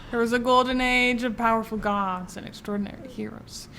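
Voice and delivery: grand storybook movie narrator voice; epic fantasy narrator. Pretend. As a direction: open it like an epic Disney prologue. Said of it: "give us a twenty-second introduction to the movie".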